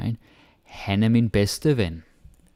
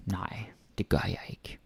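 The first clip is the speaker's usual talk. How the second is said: whisper; 11 dB quieter than usual. Shown as a demonstration. Casual real-world answer example.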